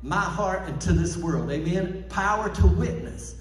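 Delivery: loud, high, fervent preaching; full-force preaching. Acted. Pastor firing up the church, full-force preaching. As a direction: preach it hard and drive it home.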